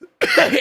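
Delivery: sharp real cough; hard cough. Real, not acted. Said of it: "Sorry for all the coughing".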